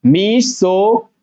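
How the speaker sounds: मीसो drawn out slowly, "mee-so"; long EE in मीसो. Shown as a demonstration. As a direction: stretch the first vowel of मीसो. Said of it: "मी, ए का sound आ रहा है". (मीसो को खींचकर धीरे बोलें)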